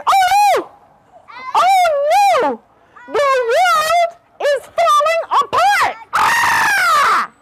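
very high, loud rabbit shriek; panicked scream. A narrator's reading. Storyteller as frightened rabbit fleeing a crash, panicked scream. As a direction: wail it in hammed-up terror. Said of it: "she started to scream".

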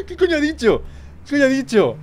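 high-pitched amused disbelief; laughing. Real, not acted. Laughing at what the game character said, high and incredulous. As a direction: ask it through real laughter.

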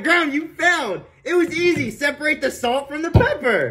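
gloating host. Pretend. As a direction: rub the failure in. Host mocking the contestant.

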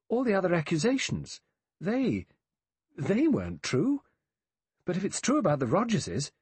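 high, faltering, urgent argument; agitated. Acted. Vera unable to make her chaotic thought clear, agitated. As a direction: struggle to get the thought out.

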